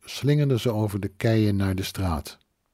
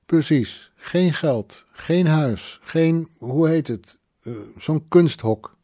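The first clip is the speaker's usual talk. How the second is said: lightly voiced drunk woman, raised pitch; tipsy woman listing; 6 semitones higher, slower than usual. Drunk woman agreeing about what Joseph lacked without Pia. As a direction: tick off each loss emphatically.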